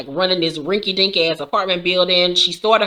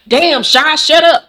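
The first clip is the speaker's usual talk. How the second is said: higher and louder mock shout at her; imitating a fed-up robber snapping; annoyed yell; 8 semitones higher, 7 dB louder, slower than usual. Gunman snaps at his accomplice by name, mock loud yell. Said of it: "the dude was like".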